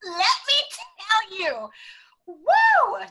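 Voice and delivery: high, loud whoop into the story; eager exclamation. Real, not acted. Guest answering the coach's "tell me", eager exclamation.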